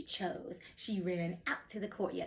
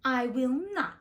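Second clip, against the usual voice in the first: higher and louder witch's retort; 4 semitones higher, 7 dB louder than usual. Acted. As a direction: snap it back like a spiteful witch. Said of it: "retorted the witch".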